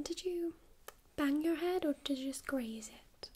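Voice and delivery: normal talking voice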